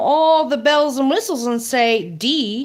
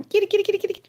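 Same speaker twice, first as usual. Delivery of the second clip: high sing-song cat call; 9 semitones higher, much faster than usual. Real, not acted. Calling the cat on camera.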